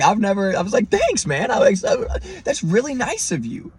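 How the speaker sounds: high mock-polite voice. Retold thanking cop, high pitched.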